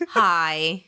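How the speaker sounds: hi in a British accent; British-style hi, dropped in pitch. Shown as a demonstration. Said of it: "in a British accent".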